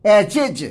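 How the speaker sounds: एजेज as "e-jej", closing on a voiced j; two-syllable j ending. Shown as a demonstration. Teacher insists on the only reading, एजेज with a final j. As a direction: say एजेज in two beats, j in both. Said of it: "only एजेज".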